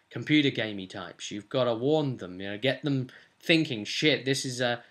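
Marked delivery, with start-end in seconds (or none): none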